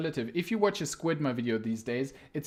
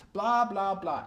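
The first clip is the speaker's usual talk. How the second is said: mocking blah blah; 5 semitones higher, 3 dB louder than usual. Mocking clickbait titles.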